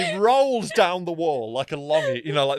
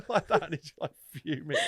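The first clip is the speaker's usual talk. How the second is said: usual speaking voice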